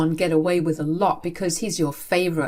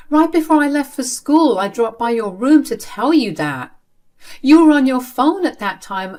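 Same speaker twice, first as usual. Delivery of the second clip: higher, louder defensive outburst; exasperated protest; 6 semitones higher, 4 dB louder than usual. Acted. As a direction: insist she did tell him. Daughter defends herself in argument.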